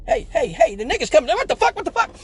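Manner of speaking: very high, loud frantic squeals; shrieking cartoon deer alarm; panicking deer. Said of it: "the deer".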